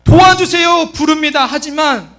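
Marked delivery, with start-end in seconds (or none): 0.0-0.9 loud, high call for help; urgent plea shouted out; earnest cry. Acted. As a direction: call out pleadingly, pitched up. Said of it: "간절하게 불러요".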